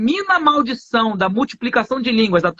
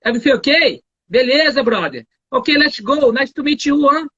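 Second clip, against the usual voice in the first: English with a light carioca swing; easy carioca guy greeting in English; carioca accent; 2 semitones higher than usual. Retold or imitated. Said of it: "O carioca vai chegar". (inglês com leve sotaque carioca)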